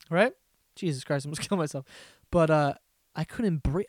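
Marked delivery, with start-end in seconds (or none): none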